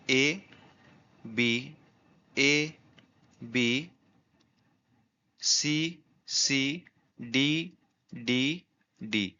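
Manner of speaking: slow, spaced-out letters, like dictation; spelling out rhyme scheme. Shown as a demonstration. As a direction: read each letter alone, with a long pause after it. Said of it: "इसकी rhyme scheme देखिए".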